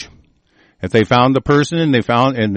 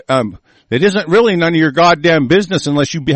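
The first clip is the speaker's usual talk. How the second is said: ordinary delivery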